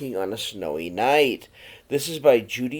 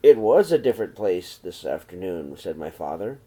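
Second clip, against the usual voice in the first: gentle read-aloud storytelling voice; story narration. A narrator's reading. Teacher reading a snowy night story to the children, in a gentle read-aloud voice. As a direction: read it to the class like a picture book. Reads picture book to class.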